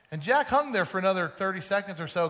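ordinary talk

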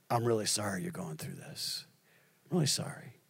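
low, hushed retold consolation; gentle sympathy. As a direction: imitate a friend's soft, sincere sympathy. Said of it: "And the way he said that".

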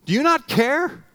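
high, loud pleading cry to God; desperate plea. Acted. Believer crying out to God in a storm, high desperate plea. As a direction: plead up high like the Lord abandoned you.

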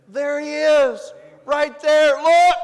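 high, very loud cries; excited shouted announcement. Acted. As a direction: shout it out to a crowd of onlookers. Acts John calling out the sighting.

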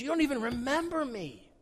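high, wounded plea of a betrayed lover; hurt and betrayed. Acted. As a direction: let the hurt show in every word. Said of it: "You can sense the pain and betrayal in God's voice here".

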